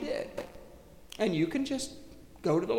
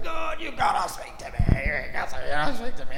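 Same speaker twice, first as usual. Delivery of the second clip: hammed-up frantic prayer; desperate pleading; 4 semitones lower than usual. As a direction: mock-desperate shouted plea to God.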